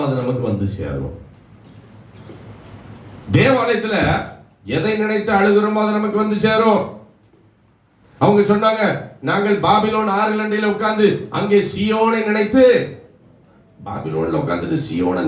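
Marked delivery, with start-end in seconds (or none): 3.4-12.6 high, sustained proclamation; preacher proclaiming to the congregation; impassioned preaching. Real, not acted. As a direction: declaim scripture in a raised, ringing monotone. Preacher proclaims and quotes scripture.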